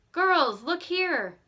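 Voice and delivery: eager summons; excited call. A narrator's reading. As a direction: come see what I found. Nancy excitedly shows pictures.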